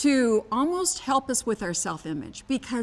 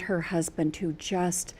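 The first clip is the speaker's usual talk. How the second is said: whisper; 5 semitones lower, 7 dB quieter, slower than usual. Real, not acted. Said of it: "leans in whispering".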